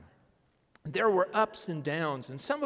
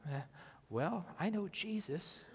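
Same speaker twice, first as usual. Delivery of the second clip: sheepish mumble; 3 semitones lower, 10 dB quieter than usual. Retold or imitated. Timid sharing of faith.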